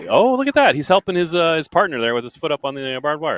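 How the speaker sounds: high, loud burst of delight; real excitement. Real, not acted. Commentator spotting teamwork at the barbed wire, excited. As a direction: exclaim it as the action unfolds.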